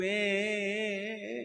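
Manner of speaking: long, drawn-out mock cry; imitated baby wail. Preacher imitates little boy crying, drawn-out wail. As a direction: hold one droning wail like a bawling baby. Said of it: "Chintu रो रहा".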